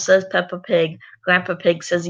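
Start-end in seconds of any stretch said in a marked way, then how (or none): none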